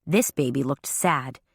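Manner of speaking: usual speaking voice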